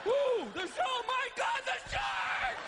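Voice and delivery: very high hammed-up shriek; comic panic, pitch shooting way up; panicked scream. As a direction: scream in mock terror at sharks. Acting out panic at sharks.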